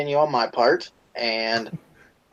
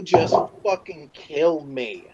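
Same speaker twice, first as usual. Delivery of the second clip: usual speaking voice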